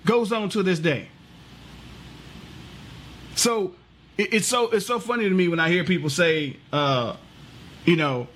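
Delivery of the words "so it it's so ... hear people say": ordinary talk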